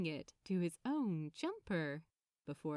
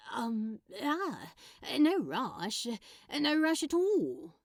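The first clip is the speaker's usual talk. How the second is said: flustered eagerness; 3 semitones higher, 7 dB louder than usual. Acted. Crowley flustered, eagerly accepting the invitation. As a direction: trip over yourself to say yes.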